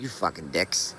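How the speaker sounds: muttered curse. Retold or imitated. Soaked walker cursing the passing car, muttered under breath. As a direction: grumble it to yourself as the car passes. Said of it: "that was what I was thinking".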